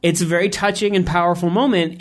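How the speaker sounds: ordinary talk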